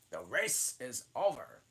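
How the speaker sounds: loud announcing call. Acted. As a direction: announce it like a referee. Dodo calls out end of race.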